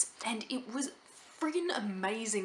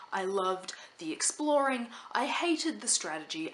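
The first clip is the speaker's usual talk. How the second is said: ordinary talk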